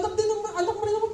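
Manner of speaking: rushed, tumbling repeat; fast repeat. Acted. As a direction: rattle the repeated plea off fast. Repeats the plea faster. (mabilis na pag-ulit ng pakiusap)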